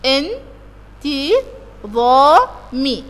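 very slow, syllable by syllable: ان، تي، ظا، مي; split into syllables. Shown as a demonstration. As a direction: tap out each beat of ان تي ظا مي with a pause between. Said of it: "يبقى أنا عندي".

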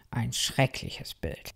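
low, slow, ghostly whisper; spooky whisper. A narrator's reading. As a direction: breathe it out like a horror picture. Imagining himself never moving.

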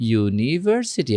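"ইউনিভার্সিটি" begun with a clear yoo, slowly; yoo sound at start. Shown as a demonstration. Teacher stressing the yoo sound of university to students. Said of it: "এখানে উচ্চারণ হচ্ছে কিন্তু ইউর মতো".

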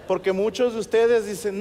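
normal talking voice

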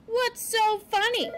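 high, indignant little question; demanding. A narrator's reading. Poppy confronting the laughing porcupine, demanding. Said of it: "demanded Poppy".

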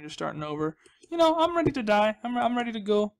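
ordinary delivery

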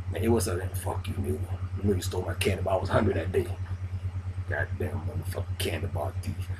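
low, soft grumbling rant over a stolen candy bar; imitating a petty grudge. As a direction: sulk it out like a man still mad from sixth grade. Grudge-holder rants about candy bar.

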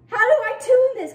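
high, loud exasperated question; frustrated outburst. Real, not acted. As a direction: cry out, at a total loss. Frustrated, no tuning tool.

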